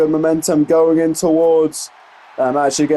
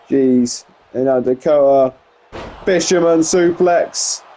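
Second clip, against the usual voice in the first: ordinary talk, nothing marked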